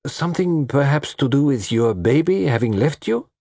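Poirot delicately probing; cautious guess. A narrator's reading. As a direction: venture it carefully, rising in pitch. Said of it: "Poirot hazarded cautiously".

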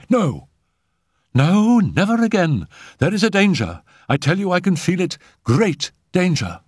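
very high, urgent warning; excited, agitated. Acted. Simone interrupts Raoul to warn of danger, agitated. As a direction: cry out against the danger, agitated. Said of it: "speaking excitedly".

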